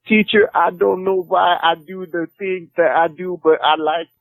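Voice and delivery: lightly hammed-up puzzled beginner asking teacher; mock confused student. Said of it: "but I make him sound like this".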